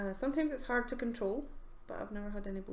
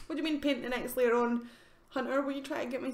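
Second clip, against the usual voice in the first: louder, puzzled echo of a suggestion; baffled query; 3 semitones higher, 5 dB louder, faster than usual. Real, not acted. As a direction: throw it back, louder and confused. Puzzled reply to the viewer's message.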